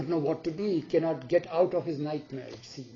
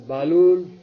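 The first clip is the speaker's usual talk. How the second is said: loud, drawn-out call of a name; acted royal hail, much louder; 10 dB louder than usual. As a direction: summon him as a king would. King addresses Balul before the throne.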